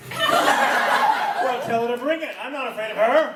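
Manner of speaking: high, defiant bluster; mock bravado. Mock bravado, then admits fear.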